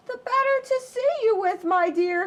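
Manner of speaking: wolf hiding behind grandma's voice; wolf as granny. Acted.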